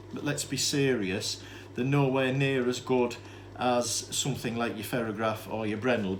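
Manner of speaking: usual speaking voice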